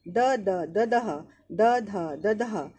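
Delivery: দদহ slowly, the first দ cut short by the হলন্ত; slow conjunct drill. Shown as a demonstration. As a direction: chant the cluster slowly. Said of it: "উচ্চারণটা half হয়ে যায়". (দদহ ধীরে, হলন্ত দিয়ে)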